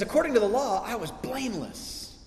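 mock boasting. Preacher voicing Paul claiming perfection, tongue-in-cheek boast. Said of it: "What's with this tone in Paul's voice".

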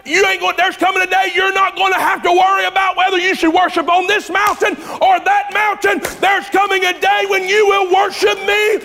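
climax the sermon with fire; preaching at full force. Acted. Preacher crying out to the congregation at full force.

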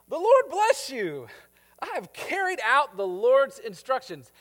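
high, loud, slow-starting eager hello; eager greeting. Acted. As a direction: greet him like a proud servant. Saul greets Samuel eagerly.

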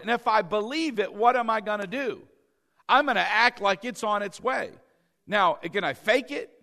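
normal talking voice

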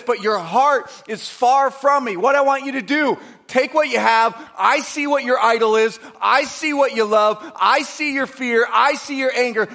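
loud, high pulpit voice speaking as Jesus; impassioned preaching. Acted. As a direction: plead and confront the listener with force.

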